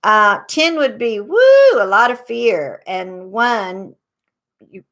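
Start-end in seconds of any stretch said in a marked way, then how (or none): 1.2-1.7 a sudden high, loud whoop; excited woo. Acted. Healer to viewers, rating ten with a loud high woo. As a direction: let out a high burst of a woo.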